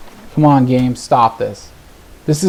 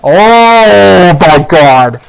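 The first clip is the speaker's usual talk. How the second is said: very long, loud, high wail of disbelief; exasperated cry; 5 semitones higher, 10 dB louder, much slower than usual. Real, not acted. Player reacting to yet another land, exasperated cry. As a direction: stretch it out into a loud outraged cry.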